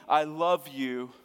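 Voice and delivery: slow, tender fatherly reassurance; kind voice. Acted. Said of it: "hear his kind voice this morning".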